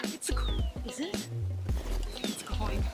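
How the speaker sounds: hushed, breathy whisper of discovery; breathless treasure hunter. Real, not acted. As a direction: whisper it like you've spotted treasure. Hushed excitement at first find.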